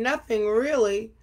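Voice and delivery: usual speaking voice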